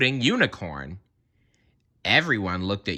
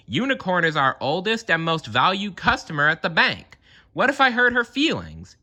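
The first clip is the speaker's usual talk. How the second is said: rising higher and higher in worry; worried Rabbit; 10 semitones higher than usual. Acted. Rabbit fretting to the committee, voice climbing anxiously. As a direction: fret that you might hurt her. Rabbit frets about Unicorn.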